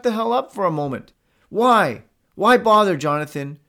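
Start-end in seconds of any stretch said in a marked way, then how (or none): none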